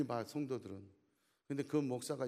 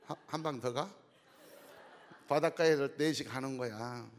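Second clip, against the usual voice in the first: ordinary talk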